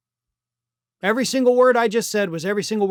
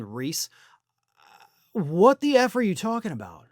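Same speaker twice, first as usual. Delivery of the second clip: ordinary delivery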